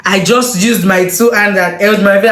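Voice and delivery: ordinary talk, nothing marked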